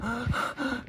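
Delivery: sharp, high acted gasps; panicked gasp. Mandy wakes to a threat, panicked high gasp. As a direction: gasp fast in fright. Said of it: "She freaks out".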